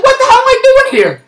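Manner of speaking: very high-pitched indignant cry; re-enacted comic exasperation; exasperated outburst. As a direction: squeal in fed-up disbelief.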